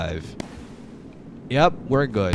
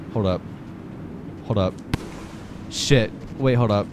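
usual speaking voice

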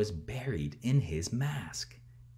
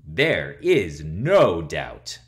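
ordinary delivery

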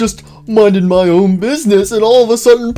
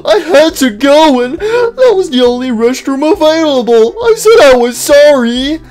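high, loud, exasperated excuses; pleading protest; 10 semitones higher, 4 dB louder than usual. Acted. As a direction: beg them to believe you, voice pitched high. Pleading with mocking friends.

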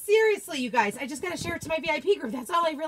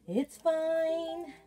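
ordinary talk, nothing marked

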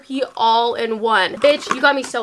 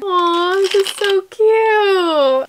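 high, drawn-out coo; high-pitched, stretched-out delight; gushing; 7 semitones higher, 4 dB louder, much slower than usual. Real, not acted. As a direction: coo over something adorable. Gushing over a gift.

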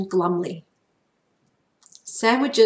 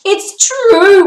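much higher, much louder cry; shout; 12 semitones higher, 13 dB louder than usual. A narrator's reading. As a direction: shout it to convince Mum. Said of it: "Marty shouted".

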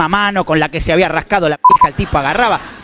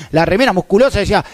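usual speaking voice

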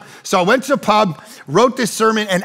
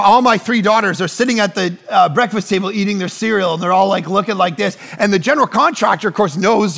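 ordinary talk, nothing marked

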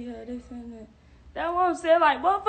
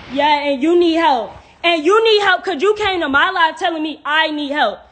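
loud, raised-pitch comeback; indignant retort; 4 semitones higher, 6 dB louder than usual. Real, not acted. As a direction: throw the insult back, loudly. Throws insult back at viewer.

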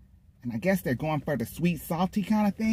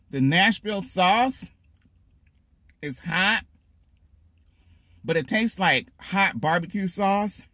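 normal talking voice

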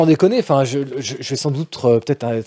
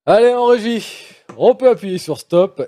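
loud, higher call to the booth, slow; 7 semitones higher, 4 dB louder than usual. Real, not acted. Host calling slowly to the control room.

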